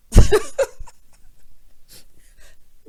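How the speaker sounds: loud burst of laughter; big laugh trailing into breathless wheeze. Real, not acted. Laughing at the housewife's made-up word, loud cackle.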